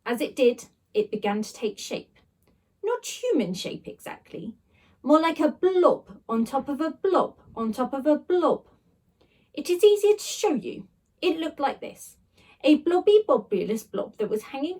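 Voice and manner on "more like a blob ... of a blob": plain reading voice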